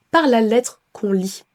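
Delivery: normal talking voice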